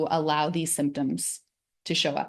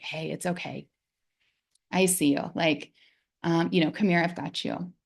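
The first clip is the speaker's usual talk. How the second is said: soothing voice; 3 dB quieter than usual. Shown as a demonstration. Comforting a child scared of monsters, softly soothing. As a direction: hush a frightened child to sleep. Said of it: "in a really soothing voice".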